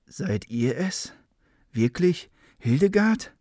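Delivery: doubting what he sees, voice climbing; unsure. A narrator's reading. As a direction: unsicher fragend. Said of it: "fragte er unsicher".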